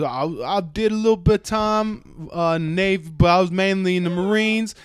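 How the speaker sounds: hoger en luider, opgeschept antwoord; boastful bluffing. Retold or imitated. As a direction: brag it out, loud and high. Fake soldier bluffing loudly.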